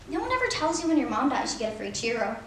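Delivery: ordinary delivery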